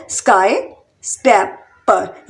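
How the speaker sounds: Sky, then scraper in slow separate beats; split into syllables. Shown as a demonstration. Teacher showing learners three syllables, syllable by syllable. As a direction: pause between sky, स्क्रै and पर. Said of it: "इसमें भी three syllable".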